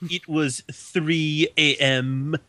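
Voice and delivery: slow, drawn-out know-it-all correction; pedantic nerd. Acted. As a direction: stretch each word like a smug pedant.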